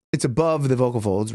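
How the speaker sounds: ordinary talk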